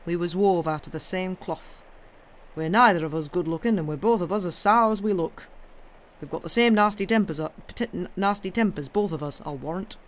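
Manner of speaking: gruffly frank Yorkshire moor man; emphatic, plain-speaking Yorkshire countryman; blunt old Yorkshire gardener. Acted. Old gardener tells the girl they are alike, blunt Yorkshire.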